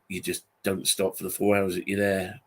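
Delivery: ordinary talk, nothing marked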